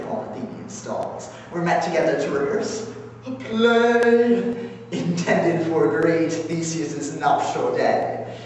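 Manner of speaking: gleeful, theatrical storyteller with big swings in pitch; animated mischief-maker reporting a prank; Puck. Acted. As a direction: relish the tale, stretching key words. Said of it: "And now, Puck".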